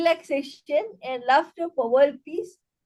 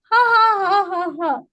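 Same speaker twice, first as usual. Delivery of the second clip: punctuate the introduction with a forced laugh; rhythmic staged laughter; laughter yoga laugh; 5 semitones higher, 4 dB louder than usual. Shown as a demonstration. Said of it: "in a laughter yoga way".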